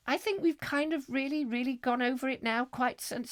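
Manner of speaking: high prim wife voice. Acted. Wife answering husband about baby, high prim voice. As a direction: pitch it up as the fussing wife.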